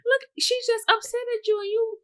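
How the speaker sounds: high, hyped-up pep talk to oneself; proud self-praise. Acted. Speaker congratulating self on an adult conversation, playfully proud. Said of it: "I'd be so proud of myself".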